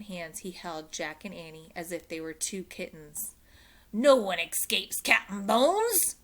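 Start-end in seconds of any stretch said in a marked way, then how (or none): 3.9-6.2 louder, slow pirate boast; lightly voiced pirate roar; pirate captain boasting over his captives; mild roar. A narrator's reading. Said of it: "he roared".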